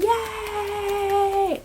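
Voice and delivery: long, high mock cheer; drawn-out high-pitched yay; cheering crowd. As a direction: imitate a room cheering, held high. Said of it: "everybody in the room starts clapping".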